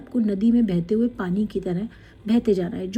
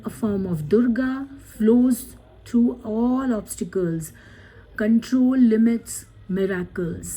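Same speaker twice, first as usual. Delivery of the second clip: slow card reading; much slower than usual. Acted.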